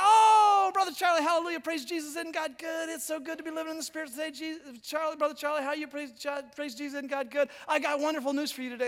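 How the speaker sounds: high, rapid, effusive church-lady gush; shower the pastor with hallelujahs; gushing Pentecostal lady. Retold or imitated. Sue ambushing the pastor after church, high and gushing. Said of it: "Sue comes over, and says".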